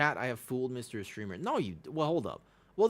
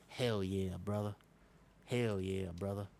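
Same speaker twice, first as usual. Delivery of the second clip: put-on Texas accent; 3 semitones lower, 4 dB quieter than usual. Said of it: "you sound like you're from Texas".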